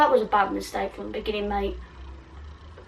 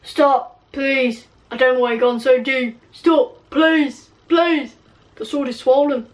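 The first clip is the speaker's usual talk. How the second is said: mock pained pleading; 2 semitones higher, 4 dB louder than usual. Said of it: "Ron screamed".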